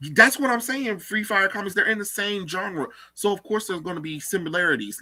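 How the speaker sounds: high, exasperated rant. Real, not acted. Exasperated rant about genre.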